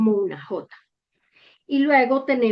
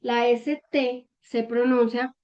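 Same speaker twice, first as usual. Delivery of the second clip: ordinary talk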